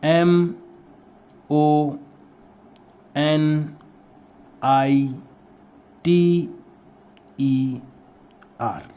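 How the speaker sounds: letters spelled out one by one with long pauses; teacher spelling aloud letter by letter while writing; slow dictated letters. Shown as a demonstration. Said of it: "शुरू करते हैं इसको".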